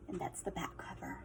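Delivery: soft, breathy whisper; whispered aside. Real, not acted. Teacher whispering to children at the back cover. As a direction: whisper it as you close the book.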